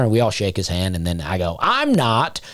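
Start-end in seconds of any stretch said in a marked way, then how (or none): 1.6-2.4 mock small child reply, higher; high-pitched kid voice. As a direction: squeak it out like a little kid. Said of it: "You hear real high-pitched".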